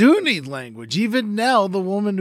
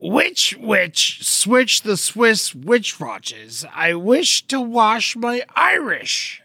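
slow, determined tongue twister retry; 4 semitones higher, much slower than usual. Shown as a demonstration. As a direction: creep through each word. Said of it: "Let me try one more time".

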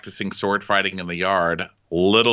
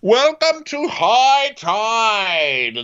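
high, slow, showy welcome; grand host voice; 8 semitones higher, 4 dB louder, slower than usual. Acted. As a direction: welcome guests like a hotel host.

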